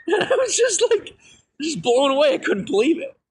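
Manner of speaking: overwhelmed, half laughing, half crying; real emotional laughing sob; laughing through tears. Real, not acted. Recalling father saying he's proud.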